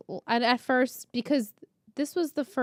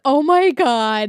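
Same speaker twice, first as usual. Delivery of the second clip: excited gasp; 3 semitones higher, 10 dB louder, much slower than usual. Retold or imitated. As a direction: stretch it out in thrilled disbelief. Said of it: "Michelle and I were like".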